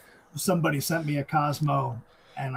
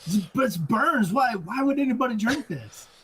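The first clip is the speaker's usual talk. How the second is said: imitating a choking gasp, much higher and louder; mock shock at burning drink; 10 semitones higher, 3 dB louder than usual. Luke tasting Fireball for the first time, mock shocked and high. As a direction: play the kid shocked by the burn. Luke's first taste of liquor.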